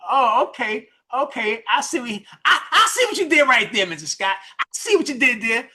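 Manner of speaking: high-pitched mock "aha" reaction; acted realisation. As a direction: act like it just clicked, pitch jumping up. Listener's sudden realisation acted out.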